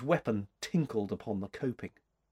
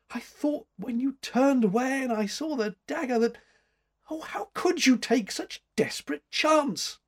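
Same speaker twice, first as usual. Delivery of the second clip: high-pitched, breaking off into dismay; alarmed reproach; 12 semitones higher than usual. A narrator's reading. Woman scolding her rescuer for his risk, alarmed. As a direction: fret over the danger, pitch high.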